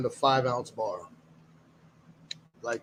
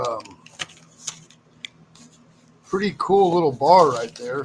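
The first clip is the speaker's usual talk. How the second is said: usual speaking voice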